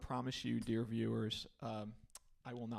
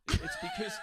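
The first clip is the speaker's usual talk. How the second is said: laughing; 8 dB louder than usual. Real, not acted. Co-host laughing mid-sentence.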